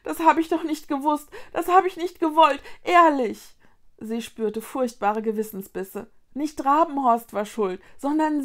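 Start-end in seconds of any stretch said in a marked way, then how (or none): 0.0-3.6 loud, higher pleading; distressed protest. A narrator's reading. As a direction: plead innocence, pushing each phrase. Vicky protests out of guilt.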